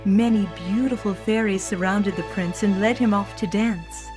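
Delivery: usual speaking voice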